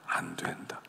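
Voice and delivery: whispered, low echo of the refusal. Retold or imitated. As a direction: repeat it under your breath. Echoing God's refusal. (속삭이듯 되뇌는 거절)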